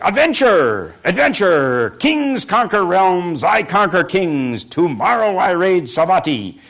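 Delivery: loud, high bragging bandit; slow, booming boasts of conquest; boastful robber chief. Acted. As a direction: crow it out like a bandit king. Robber boasting alone in cave.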